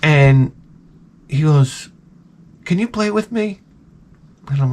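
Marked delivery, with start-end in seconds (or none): 2.6-3.6 put-on higher, small angelic child voice; sweet little boy. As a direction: imitate a sweet child, pitched well up. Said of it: "he said it in such a sweet way".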